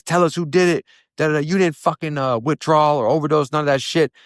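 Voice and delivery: high-pitched mock interrogation; demanding officers. Officers pressing a beaten inmate for names, demanding. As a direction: imitate officers demanding answers, pitched well up.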